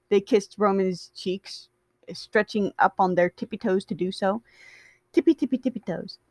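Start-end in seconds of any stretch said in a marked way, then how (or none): 5.1-6.2 quick, cute, teasing singsong; playful sing-song. Real, not acted. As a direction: savor the silly words. Playful repeat.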